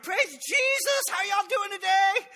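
suddenly beaming greeting at the church door; fake cheerful. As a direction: switch to over-the-top churchy sweetness.

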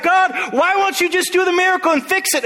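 high pleading congregant voice; exasperated plea. Acted. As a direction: whine at God to just fix it. Congregant begging God for miracle.

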